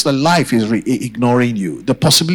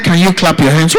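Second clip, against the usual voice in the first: insistent repeat; 2 semitones higher, 4 dB louder than usual. Real, not acted. Preacher asking the church to clap once more, insistent. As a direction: press the congregation to respond, voice lifted. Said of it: "I said".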